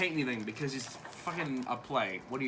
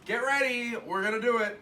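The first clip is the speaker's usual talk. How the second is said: higher-pitched eager announcement; rally them lightly, pitch lifted; acted pep call; mock rallying call; 7 semitones higher than usual.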